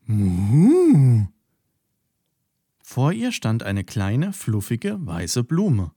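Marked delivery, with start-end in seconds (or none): 0.1-1.3 loud, swooping two-note moo of wonder; a cow astonished by a dandelion clock; amazed moo. Acted. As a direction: moo in delighted surprise. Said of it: "Erstaunt blieb sie stehen".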